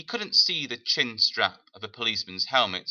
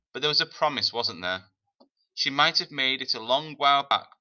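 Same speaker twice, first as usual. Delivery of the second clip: ordinary delivery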